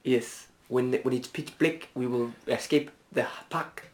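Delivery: soft, put-on fox voice of unplaceable accent; quiet cartoon fox plotting an escape; fox character. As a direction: play a hushed, scheming fox. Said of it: "That was a fox again".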